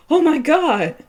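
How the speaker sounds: loud high gasp of shock; shocked outcry. Real, not acted. Player reacting to Karen's cruelty, loud shocked outcry. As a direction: blurt it out in disbelief.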